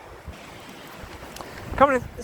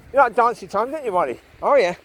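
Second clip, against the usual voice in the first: talking to the dog; 5 semitones higher, 6 dB louder than usual. Real, not acted. Cooing to the dog Molly.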